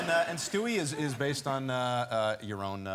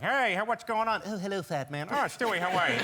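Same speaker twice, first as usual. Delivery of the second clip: higher, louder cartoon voices back and forth; switching character voices; 7 semitones higher, 3 dB louder than usual. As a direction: jump between the characters fast. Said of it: "I have to jump back and forth".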